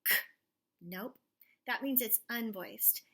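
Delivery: ordinary talk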